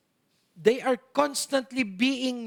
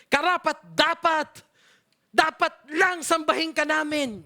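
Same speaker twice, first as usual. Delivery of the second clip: karapat dapat proclaimed louder and higher; forceful declaration; 6 semitones higher, 4 dB louder than usual. Shown as a demonstration. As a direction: declare karapat dapat with all your might. (malakas at mariing pagbigkas ng karapat dapat)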